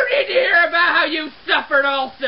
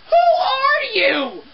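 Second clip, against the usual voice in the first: high, drawn-out cry of disbelief; exasperated outburst; 9 semitones higher, slower than usual. Acted. Cleaner to the unknown mess-maker, exasperated outburst. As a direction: wail it slowly at the culprit, pitched way up.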